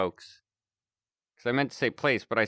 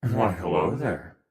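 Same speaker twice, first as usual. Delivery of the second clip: morphing voice; 5 semitones lower than usual. Acted. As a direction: voice changer, lower and altered. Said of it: "Voice changer".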